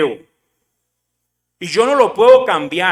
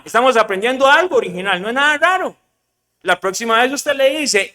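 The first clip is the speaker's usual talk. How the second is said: ordinary talk, nothing marked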